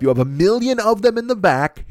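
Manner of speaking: retold disbelieving clarification; clearing it up. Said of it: "I just want to clear it up".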